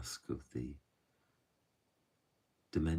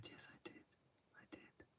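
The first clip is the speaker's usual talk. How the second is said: barely voiced, breathy whisper of a frail old woman; very quiet; 18 dB quieter than usual. Old woman whispers to the storyteller, very quietly. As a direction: whisper it like a tearful old lady answering softly. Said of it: "she just said very quietly".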